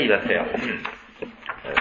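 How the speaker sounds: normal talking voice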